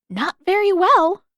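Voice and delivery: dry retort. Acted. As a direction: cut him down flatly. Katara's dry comeback.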